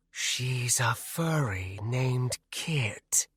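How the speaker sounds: slow, eerie breathy wraith whisper. Acted. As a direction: hush it out like a shadow spirit. Wraith Nanaya speaks.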